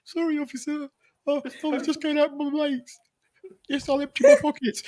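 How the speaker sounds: put-on nervous teen at a police stop, squeaking high. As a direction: play the scared lad stopped by police. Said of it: "My voice was that high".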